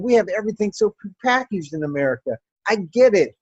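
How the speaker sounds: ordinary talk, nothing marked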